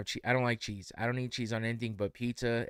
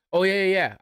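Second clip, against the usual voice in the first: eager reassurance; 8 semitones higher, 9 dB louder than usual. Retold or imitated. Cashier eager to fix order.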